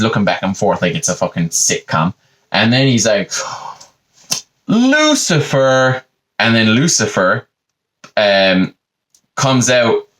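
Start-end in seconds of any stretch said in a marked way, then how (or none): 4.7-6.0 high, long drawn-out name; calling out. Retold or imitated. God calling into the garden for Lucifer, drawn-out call. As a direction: sing out the name as a sitcom summons.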